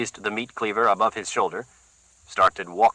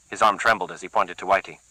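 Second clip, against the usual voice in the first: plain reading voice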